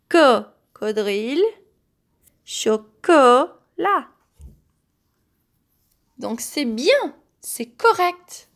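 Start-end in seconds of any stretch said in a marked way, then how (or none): 2.4-4.1 "chocolat" slowly, middle CO long and punched; stress on CO. Shown as a demonstration. Teacher showing children the syllable ko, "chocolat" with CO stressed. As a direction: set off the "co" in "chocolat" with pauses. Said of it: "nous avons la syllabe ko".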